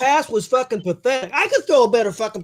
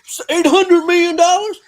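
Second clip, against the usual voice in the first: raised, whiny mock rant at the player; mock outrage over the big contract; mocking a ranting fan; 7 semitones higher, 6 dB louder than usual. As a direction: parody the angry fan, higher and louder. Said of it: "buddy Mathis had the same damn take".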